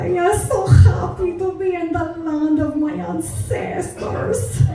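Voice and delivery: put-on high, effusive Mexican-accented cooing; Mexican mother, gushing. Mother adores the Irish people, gushing Mexican accent. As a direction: gush over them in a hammed-up Mexican accent. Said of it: "But my mother was as bad".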